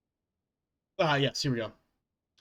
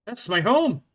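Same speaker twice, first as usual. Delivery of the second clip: put-on Scottish accent; 7 semitones higher, 6 dB louder than usual. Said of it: "No, that's me home".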